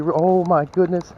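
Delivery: normal talking voice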